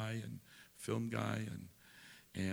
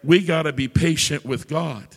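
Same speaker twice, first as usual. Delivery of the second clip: loud, slow, ringing preacher's climax; preacher building to a loud, slow climax; full-force preaching; 5 semitones higher, 15 dB louder than usual. Real, not acted. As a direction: drive the point home to the congregation.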